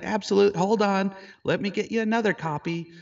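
jumping in keenly to help; eager offer. Retold or imitated. Photographer rushing to print the woman a second copy, high and eager.

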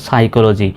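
সাইকোলজি with silent p and k sound; correct pronunciation. Shown as a demonstration. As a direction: say সাইকোলজি, starting on s. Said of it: "এটার সঠিক উচ্চারণ হলো". (প নীরব রেখে সাইকোলজি উচ্চারণ)

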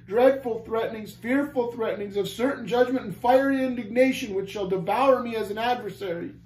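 high, impassioned outpouring of guilt; deepest anguish. A narrator's reading. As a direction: cry out each sin in torment. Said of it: "cried he in deepest anguish".

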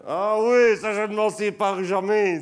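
light up over a treasured keepsake; delighted discovery. Real, not acted. Delighted find of treasured photo.

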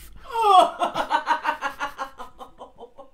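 can't hold back a laugh; burst of laughter. Real, not acted. Host laughing as a friend hurts her elbow, real laughter. Ashley smashed her funny bone.

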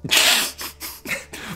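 breathy laugh. Real, not acted. Laughing at the name.

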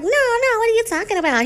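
high-pitched mock objection; retold protest. Retold protest at his comeback.

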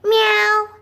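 high, loud imitation cat meow; squeaky-pitched pretend kitty. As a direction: mimic a cat meowing on cue. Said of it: "So say meow".

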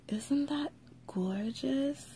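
hushed, slow admiration; softly whispered, slow awe at the tape; slow awed whisper. Real, not acted. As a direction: let the whisper linger on gorgeous.

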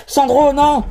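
loud, high anguished cry; desperate shout. Acted. Partner crying out as Sandro is hit, desperate shout. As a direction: scream to stop him.

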